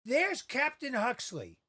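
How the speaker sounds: put-on high, loud, drawn-out child's shout; excited yell. Eight-year-old spots TV actor in supermarket, excited yell. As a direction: yell it slowly across the aisle. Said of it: "and I yell".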